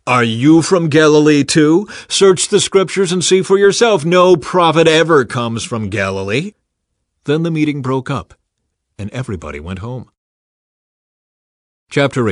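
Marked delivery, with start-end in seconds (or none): 0.1-6.5 higher, louder scornful retort. Acted. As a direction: shut Nicodemus down. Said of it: "They replied".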